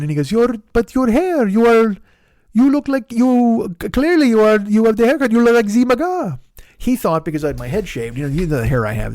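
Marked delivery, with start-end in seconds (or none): 0.3-6.4 high, loud, halting mock French; flabbergasted French accent. French passenger judging driver by his haircut, flabbergasted French accent. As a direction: sputter in hammed-up French accent, stretching words. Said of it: "stop the tortured French accent now".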